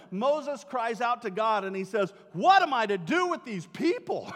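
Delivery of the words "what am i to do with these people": frustrated. Retold or imitated. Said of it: "in frustration".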